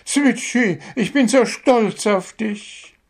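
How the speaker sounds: high, excited cheering voice; Gundula's proud call. A narrator's reading. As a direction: call out high with pride across the stands. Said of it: "Ihr Ruf hallte durch die Reihen".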